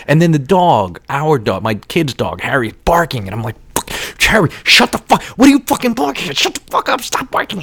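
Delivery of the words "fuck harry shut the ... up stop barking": high, frantic scolding at a dog, acted out; hammed-up exasperated rant, high and rushed; losing it. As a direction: re-enact frazzled yelling, pitched way up. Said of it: "I'm losing my shit".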